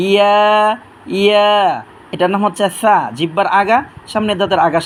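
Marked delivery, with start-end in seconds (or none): 0.1-1.5 ইয়া said as a clear drawn-out "ya"; demonstrated Arabic letter. Shown as a demonstration. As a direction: sound out the letter ইয়া slowly. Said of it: "আপনারা একটু খেয়াল করবেন". (আরবি হরফ ইয়া স্পষ্ট উচ্চারণ)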